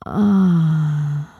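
drawn-out falling moan; long breathy moan. Acted. Woman urging her listener to surrender, long moan.

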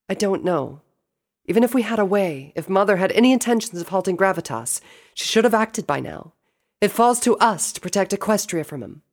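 high-pitched sister voice; doubtful at first, then high and determined; resolute princess. Acted. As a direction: argue earnestly, pitched well up. Celestia answers, doubtful then resolved.

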